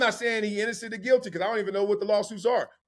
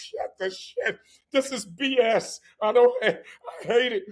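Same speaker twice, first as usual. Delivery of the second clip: mock exasperated rant; 2 semitones higher than usual. Mock rant about paperwork.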